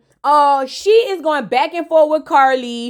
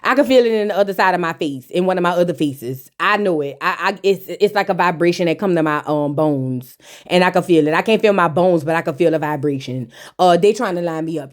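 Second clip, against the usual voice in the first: Carly voice; 8 semitones lower than usual. Retold or imitated. Recapper voicing Carly sensing an ambush, low and knowing. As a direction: drop low and play the suspicious diva. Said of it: "Carly is looking at Jessica like".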